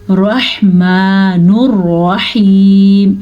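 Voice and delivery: slow, melodic tajweed recitation; Quran recitation. Shown as a demonstration. Teacher reciting a verse for her pupils, slow and chanted.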